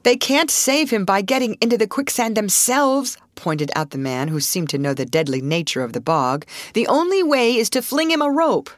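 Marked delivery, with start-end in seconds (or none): 0.0-3.2 higher, insistent warning; urgent objection. A narrator's reading. Man objects urgently at the bog. 6.7-8.7 high, forceful advice; urgent instruction. A narrator's reading. Man urges the only rescue.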